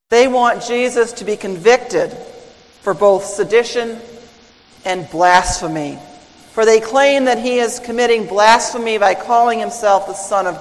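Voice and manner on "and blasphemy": normal talking voice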